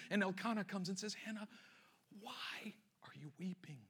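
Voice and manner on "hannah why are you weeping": hushed, nearly whispered concern; tender questioning. Acted. Husband to weeping Hannah, tender hushed questioning. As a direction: ask very softly, drawing out the why.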